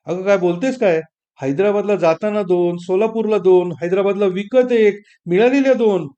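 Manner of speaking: husband reeling off the saris she already got; exasperated protest. Acted. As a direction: build from protest to near-shout.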